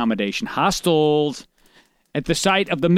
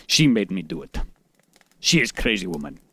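put-on Russian accent; 9 semitones lower, 4 dB quieter than usual. Husband voiced as Russian villain.

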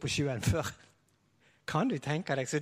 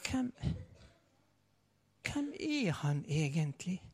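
awed hush; 7 dB quieter than usual. Retold or imitated. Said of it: "og så sier vi".

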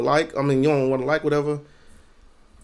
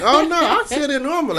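mock innocent protest; 12 semitones higher, 4 dB louder than usual. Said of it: "You be like".

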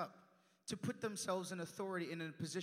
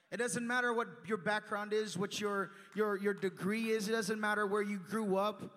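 rising preaching; 7 dB louder than usual. Real, not acted. Preaching equality at the cross.

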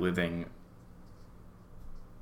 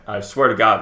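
ordinary talk

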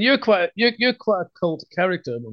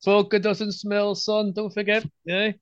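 higher-pitched teasing disbelief; mock suspicion; 7 semitones higher than usual. Host ribbing a guest who claims his drink is water, mock suspicion. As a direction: play at not believing a word of it.

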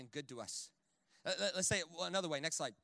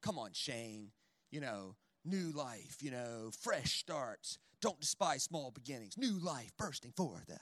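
slow, lower mock-preachy voice; mock sermonizing dad; 7 semitones lower, 7 dB quieter, slower than usual. Dad lecturing son about new life, slow and lower. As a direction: intone it like a corny pep talk. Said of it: "I could see where this was going".

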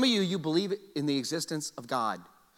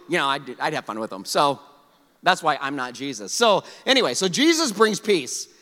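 ordinary talk, nothing marked

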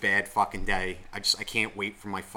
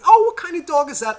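much higher, sing-song mock friendly question; chirpy stranger; 10 dB louder than usual. Mocking a cheerful couple asking about his dog, high and sweet. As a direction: play the overly cheerful dog lover.

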